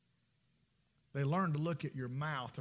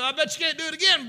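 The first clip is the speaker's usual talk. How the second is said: fast, loud, higher goading dare; taunting dare; 9 semitones higher, 11 dB louder, faster than usual. Retold or imitated. Boys goad the reckless old boy with a loud dare. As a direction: egg him on to try it again.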